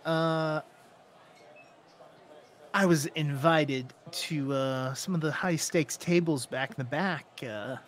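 lightly voiced sleazy gambler, hesitant; a hint of an oily come-on; flirting gambler. Game master plays flirting gambler.

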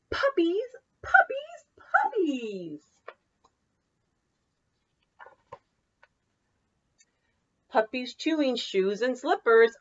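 lively singsong picture-book read; bouncy read-aloud for children. Acted. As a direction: read it like story time for little kids. Teacher reads picture book aloud.

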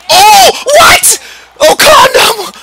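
high, loud shriek of disbelief; amazed shout. Real, not acted. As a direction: scream in real astonishment at the save. Amazed by the goalie's save.

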